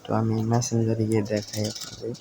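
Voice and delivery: normal talking voice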